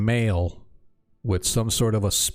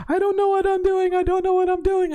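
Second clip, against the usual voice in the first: very high, rising panic, a little louder; panicked Alyssa; 3 dB louder than usual. Acted. Alyssa realizing she broke the circle, panicked high voice. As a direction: repeat it in growing horror.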